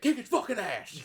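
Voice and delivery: high, very loud, drawn-out yell; crazy grandpa yelling. Retold or imitated. Grandpa cheering on kids at a video game, yelling. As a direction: yell it like a worked-up, over-invested grandpa. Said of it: "like, yelling at us".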